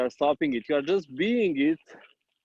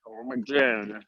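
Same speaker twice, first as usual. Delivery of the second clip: gin-mouthed speech; 5 semitones lower, slower than usual. Retold or imitated. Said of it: "mouth is going like this".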